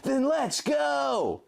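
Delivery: high far shout, slow and drawn out; rally her to jump; Link yelling. A narrator's reading. Link calling Jenna to ride for the fences, high and slow. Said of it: "Link yelled".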